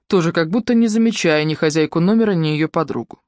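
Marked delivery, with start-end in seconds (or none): none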